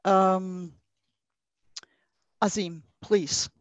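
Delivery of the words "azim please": ordinary talk, nothing marked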